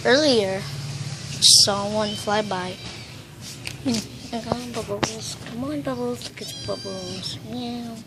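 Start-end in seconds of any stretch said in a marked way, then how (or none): none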